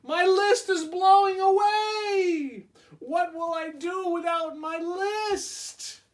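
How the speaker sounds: very high, slow frantic wail; panicked Toad. Acted. Toad losing his list, very high frantic cry. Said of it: "cried Toad".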